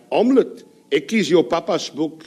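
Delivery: a hint of theatrical Afrikaans accent; mock Afrikaans. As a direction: declaim it in comic Afrikaans. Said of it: "the Afrikaans for Hamlet".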